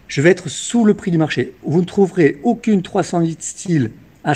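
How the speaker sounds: loud, punchy sales pitch; infomercial. Acted. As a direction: hype it up like a TV car dealer. Car dealer hard-sell pitch.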